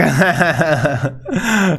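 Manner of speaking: real giggling burst; amused laugh. Real, not acted. Player after threatening to arrest crying woman, amused laughing. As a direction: laugh at your own joke. Laughs at his own joke.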